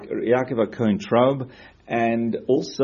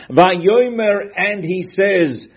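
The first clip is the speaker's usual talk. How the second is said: dramatic build; 9 semitones higher, 8 dB louder, much slower than usual. Real, not acted. Building to Yaakov's cry.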